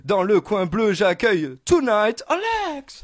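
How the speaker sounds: big booming fight-night intro; boxing ring announcer. Acted. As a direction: call out each corner like a ring announcer. Host introduces guests like boxers.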